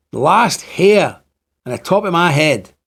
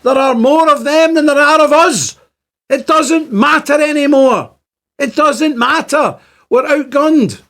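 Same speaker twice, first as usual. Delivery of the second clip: much higher and louder, slow, insistent; impassioned outcry; 12 semitones higher, 4 dB louder, slower than usual. Real, not acted. To Protestant viewers, warning it no longer matters, impassioned higher outcry. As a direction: cry it out in despairing emphasis. Ranting about being outnumbered.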